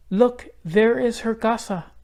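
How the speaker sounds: put-on light, high girl's voice; young girl Isabel. A narrator's reading. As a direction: lift the voice up for the little girl.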